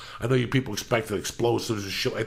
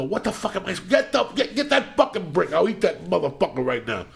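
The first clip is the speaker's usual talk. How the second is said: mock angry self-pep; 9 semitones higher than usual. Pissed at his own fear, firing himself up in pitched-up mock rage. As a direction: fire yourself up in half-mock rage. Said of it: "sometimes I get so pissed that I'm scared".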